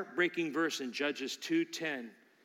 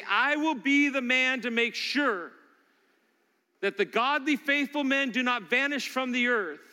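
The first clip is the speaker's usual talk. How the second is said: pledge of resolve; 8 semitones higher, 5 dB louder than usual. Acted. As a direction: make it a vow. Men urged to make a stand.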